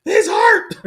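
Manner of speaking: high, loud gleeful shout; excited yell. Real, not acted. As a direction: crow it out at the screen.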